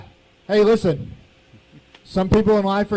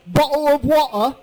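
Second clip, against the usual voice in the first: imitating a son's higher-pitched English accent; 7 semitones higher than usual. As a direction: put on an English accent, dropping the T's. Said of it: "how's my English accent?".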